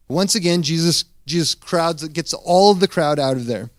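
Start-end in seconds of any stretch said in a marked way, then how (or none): none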